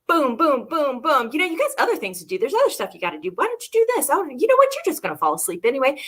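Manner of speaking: rapid, jumpy, high-swooping mental chatter; chattering inner thoughts. Retold or imitated. Meditator's busy mind interrupting the breathing exercise, playful racing chatter. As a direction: imitate distracting thoughts popping in one after another.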